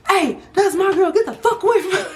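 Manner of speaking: high, loud mock yell with laughter breaking through; imitating his yell. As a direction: shout it like the jealous boyfriend on the porch, half laughing. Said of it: "He started yelling at the car".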